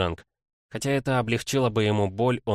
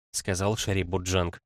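ordinary delivery

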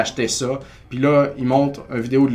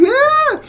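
very high, loud squeal; 8 dB louder than usual. Real, not acted. Owner squealing at the memory, very high and loud. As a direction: let out a delighted shriek.

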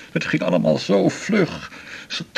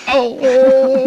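laughing through pain; 10 semitones higher, 9 dB louder than usual. Acted. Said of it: "niet lachen".

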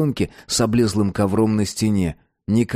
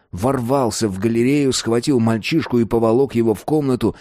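ordinary delivery, nothing marked

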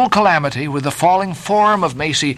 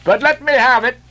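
Mayhew's high-pitched request; 11 semitones higher, 3 dB louder than usual. A narrator's reading. Mayhew asks for the letter.

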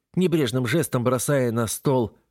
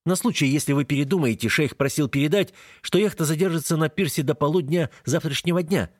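higher, called across a distance; calling after; 6 semitones higher than usual. Acted. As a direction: call it after someone leaving. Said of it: "летит мне в спину".

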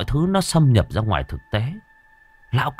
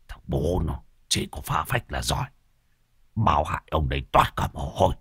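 cursing grumble; 5 semitones lower, 6 dB quieter than usual. A narrator's reading. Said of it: "mà chửi Đồng già".

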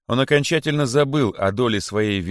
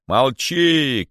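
loud, raised command; royal shout; 5 semitones higher, 5 dB louder than usual. A narrator's reading. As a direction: silence him sharply. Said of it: "крикнул король". (властный окрик)

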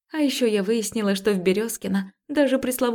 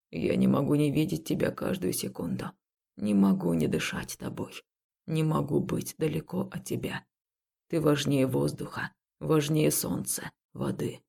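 serious tender voice; 5 semitones lower, 5 dB quieter than usual. Acted. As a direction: confess it quietly and earnestly. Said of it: "Голос мужчины стал серьёзным".

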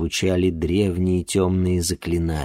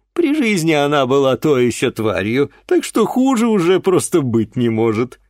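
loud, high, scornful voice; scornful man; 7 semitones higher, 5 dB louder than usual. Acted. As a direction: spit it out with contempt.

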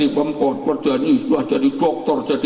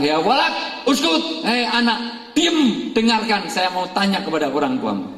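stern hush; 8 semitones higher than usual. Acted. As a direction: hush the boy firmly. Prophet hushes the child.

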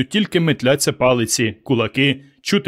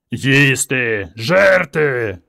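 roaring; much slower than usual. Acted. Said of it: "ревуть вони".

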